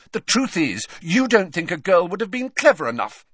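high, loud accusation; heated, indignant charge; angry. A narrator's reading. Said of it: "said Hermione angrily".

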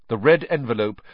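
ordinary delivery, nothing marked

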